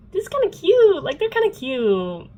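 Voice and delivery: high, loud, delighted squeal; gushing. Real, not acted. Reader warming to Clary and Jace's romance, gushing. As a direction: gush over an adorable couple. Gushing over the couple on rereading.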